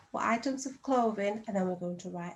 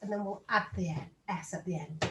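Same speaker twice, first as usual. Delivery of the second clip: ordinary talk, nothing marked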